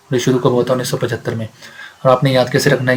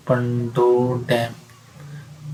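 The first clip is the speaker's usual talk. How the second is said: Pando डैम stretched very slowly and softly; slow, softer Pando डैम for emphasis; drawn-out repetition; 5 dB quieter, much slower than usual. Shown as a demonstration. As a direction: draw out each syllable of Pando डैम. Repeating the name slowly for students.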